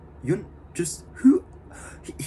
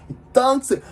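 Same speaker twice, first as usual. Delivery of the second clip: ordinary delivery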